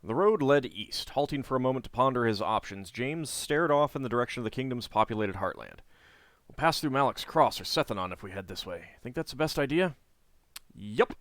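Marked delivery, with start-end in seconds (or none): 0.0-10.0 steady storybook read-aloud; story narration. A narrator's reading. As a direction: read the quest text like a novel. Reading the game's story text.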